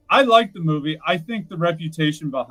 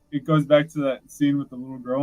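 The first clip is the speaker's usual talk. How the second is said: ordinary talk, nothing marked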